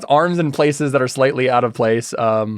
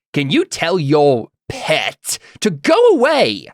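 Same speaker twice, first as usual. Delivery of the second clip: slow, pieced-together character voice; slow, halting mimicked request; someone else's voice; 2 semitones higher, slower than usual. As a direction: put on a borrowed voice, word by word. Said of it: "in someone else's voice".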